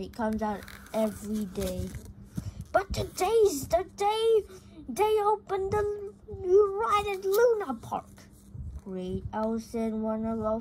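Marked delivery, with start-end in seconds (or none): 2.7-8.1 high and louder, bursting with news; excited cousin. Acted. Cousin Trap tells Geronimo about the park, high and excited. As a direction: gush it like a thrilled kid.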